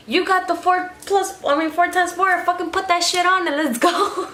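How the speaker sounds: high, frustrated demand; snapping at him to get going; exasperated. Retold or imitated. Yelling at Jose to use 4x4.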